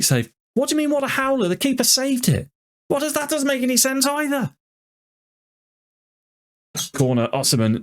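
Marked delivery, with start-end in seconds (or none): 0.5-4.5 high, incredulous protest; indignant disbelief. Real, not acted. Player protests a howler rating, high and indignant. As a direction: scoff at the keeper's rating.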